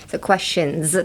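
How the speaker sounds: questions ending in a buzzing Z. Shown as a demonstration. Teacher stresses the Z in questions. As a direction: end questions on a Z. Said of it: "it sounds like a Z".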